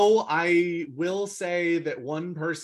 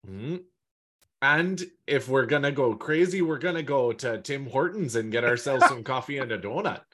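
mock Canadian accent. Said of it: "offensive accent segment".

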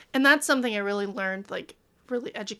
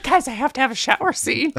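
excited; 4 semitones higher, 9 dB louder than usual. Retold or imitated. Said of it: "And I was like".

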